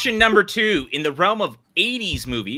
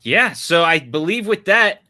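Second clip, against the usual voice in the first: ordinary talk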